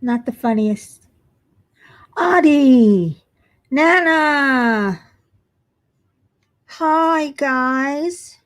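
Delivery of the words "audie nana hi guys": high, drawn-out singsong hello; long, falling high-pitched greeting calls; calling out greetings. Real, not acted. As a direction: hail friends as they arrive, stretching each name. Greeting arriving viewers by name.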